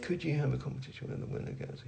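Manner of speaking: reads it under his breath, fast; quiet mutter. Real, not acted. Founder skimming a viewer's question to himself, muttered. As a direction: skims the question half to himself. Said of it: "we're gonna go with".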